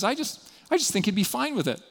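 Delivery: high, offhand excuse to friends; lightly voiced breezy rationalizer; casual self-justifier. Voices congregant talking to friends.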